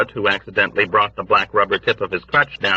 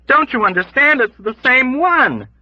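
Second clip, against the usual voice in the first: emphatic shout; 4 dB louder than usual. Acted. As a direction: make him understand by shouting. Dunbar shouting over chaos.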